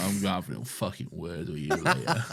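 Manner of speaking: half-laughing tough-guy warning; menace, cracking up; laughing mock threat. Co-host plays Padme warning the handmaiden, laughing through it.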